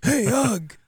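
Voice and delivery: put-on rough gravelly old soldier voice. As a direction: growl it like a gruff ex-soldier. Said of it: "'cause he had this gravelly voice".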